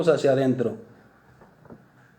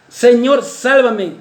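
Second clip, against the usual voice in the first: loud, higher cry for help; Peter's shout; 6 semitones higher, 5 dB louder, much slower than usual. Retold or imitated. Peter sinking in the sea, crying loud to Christ. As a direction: cry out as if drowning. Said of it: "Pedro grita".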